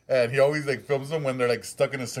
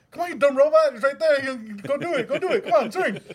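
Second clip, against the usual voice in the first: much higher, louder, cheering a stuck robot on; mock coaxing; 12 semitones higher, 4 dB louder than usual. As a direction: urge it on like a sports fan. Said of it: "So he's like".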